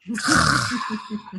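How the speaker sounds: imitated wild-creature growl; short burst of cartoon-beast noise; Tasmanian devil noise. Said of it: "she's like the Tasmanian devil".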